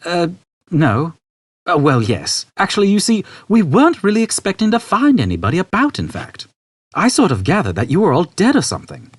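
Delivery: put-on awkward, hesitant young man; flustered Arthur. Arthur to the old man, awkwardly flustered. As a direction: stumble over the excuse.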